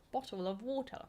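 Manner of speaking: bottle of water in British accent, rising on water; second British take of bottle of water; British accent, another way. Shown as a demonstration. Viewer asks, tries another British version. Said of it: "there's different ways".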